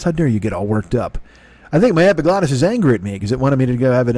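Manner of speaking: ordinary talk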